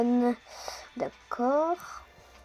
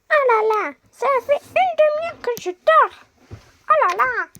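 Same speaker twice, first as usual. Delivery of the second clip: much higher, louder, just-woken prisoner; 11 semitones higher, 5 dB louder than usual. Acted. Prisoner complains she has been woken. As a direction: whine like a groggy prisoner.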